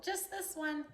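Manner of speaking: owning up small; sheepish confession. Retold or imitated. Imitating her friend timidly admitting to the cop she drank one. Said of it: "she's all".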